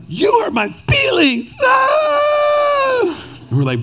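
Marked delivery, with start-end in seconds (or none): 0.1-1.5 put-on high, slow whiny toddler voice. Imitating two-year-old daughter whining at her parents. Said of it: "she starts going". 1.6-3.3 high, mock toddler wail. Imitating daughter wailing at her parents. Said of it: "she starts going".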